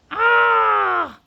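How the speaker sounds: loud, high despairing wail. A narrator's reading. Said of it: "wailed Flabbergast".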